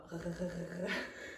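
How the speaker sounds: throaty gargling R sound, low and soft; gargled French R. Shown as a demonstration. Teacher showing how to gargle the R. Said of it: "and you go".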